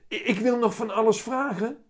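put-on boy calling out. A narrator's reading. Willem objects as the cat stops talking, high call. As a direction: object urgently in a small boy's voice. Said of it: "roept Willem".

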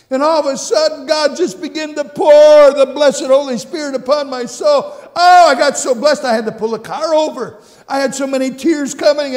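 shouted high, rising to overcome cries of glory; ecstatic preaching. Real, not acted. Preacher reliving his blessing in the car, shouting with joy. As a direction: let the joy burst out loud and high.

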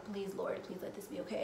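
a soft, pleading murmur to God; hushed prayer. Retold or imitated. Praying in the car at night, hushed and pleading. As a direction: pray it under your breath, worried. Silent prayer during breakdown.

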